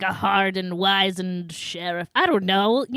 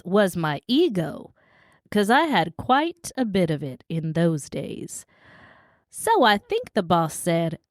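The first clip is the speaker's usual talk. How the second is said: [name]. Acted. Co-host reads the crashed actor's quote, lightly acted reminiscence. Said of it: "As Herb says".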